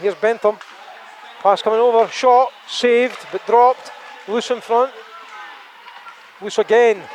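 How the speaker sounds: excited shot call; play-by-play. Acted. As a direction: build it up fast.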